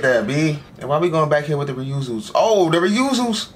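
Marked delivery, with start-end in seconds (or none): 2.3-3.5 high, slow, thrilled exclamation; excited drawn-out call. Real, not acted. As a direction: stretch it out with delight. Excited on seeing the clones.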